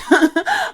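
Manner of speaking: short high laugh at herself; sick laugh. Real, not acted. Vlogger laughing at her own sickness, high laugh. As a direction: laugh off feeling ill.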